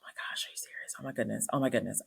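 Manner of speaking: imitated whisper of relief, turning softly voiced; relieved patient. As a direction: breathe it out softly like a client let off the hook. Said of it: "the way that her body was just like".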